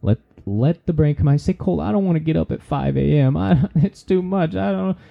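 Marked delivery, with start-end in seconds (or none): 1.5-5.0 high-pitched put-on whine of protest; hammed-up, higher-pitched objection; mock complaining. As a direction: imitate a reluctant listener making excuses. Voices a reluctant listener's objection.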